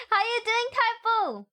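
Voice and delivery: sing-song welcome to a subscriber; hammed-up host voice; high greeter voice.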